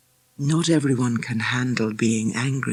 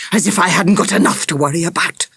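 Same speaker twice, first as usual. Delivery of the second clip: quick, louder hissed complaint; exasperated, angry and rapid; 4 semitones higher, 5 dB louder, faster than usual. A narrator's reading. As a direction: hiss it fast, fed up. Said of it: "he hissed at Peter".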